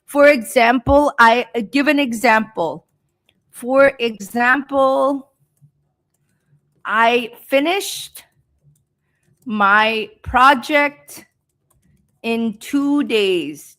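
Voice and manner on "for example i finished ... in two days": slow dictation, a pause after each phrase; slow speech. Shown as a demonstration. Teacher giving students an example sentence, slow speech. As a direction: draw out each phrase for learners. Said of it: "I'm speaking slow".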